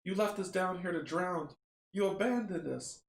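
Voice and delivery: lightly voiced accusing dead; accusing chorus of voices. The drowned crew to their captain, lightly accusing. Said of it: "a chorus of voices".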